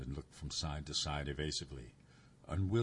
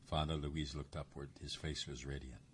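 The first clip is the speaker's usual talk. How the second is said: ordinary delivery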